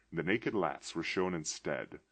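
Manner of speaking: ordinary delivery, nothing marked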